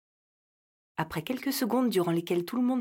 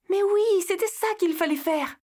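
excited; 11 semitones higher, 4 dB louder than usual. Acted. Leila's sudden idea.

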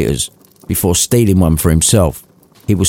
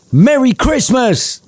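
huge greeting; slower than usual. Acted. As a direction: leap out and yell it. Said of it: "with a huge".

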